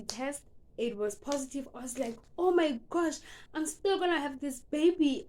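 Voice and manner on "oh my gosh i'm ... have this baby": higher and louder, thrilled; excited relief. Retold or imitated. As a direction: burst out hopeful that the baby is still there. Test still positive, joy.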